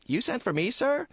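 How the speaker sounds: high, meek little voice; timid angel Clarence. Acted. Angel Clarence answers his boss, meek and high. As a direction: ask it shyly, eager to please.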